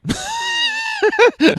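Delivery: big shouted laugh; loud burst of laughter. Real, not acted. Loud laugh at his own ridiculous ten thirty call. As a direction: crack up loudly at the memory.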